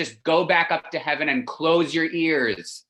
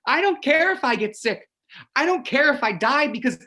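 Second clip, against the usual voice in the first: higher and louder, throwing caution away; defiant outburst; 10 semitones higher, 3 dB louder than usual. Real, not acted. Son telling Mom he'll risk everything, defiant. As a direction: declare it recklessly. Reckless vow to kiss Giorgio.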